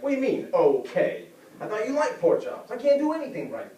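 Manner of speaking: high, loud, offended wife; hammed-up exasperated complaint; hurt outburst. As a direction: fire back, wounded and climbing in pitch. Wife texts back, hurt and angry.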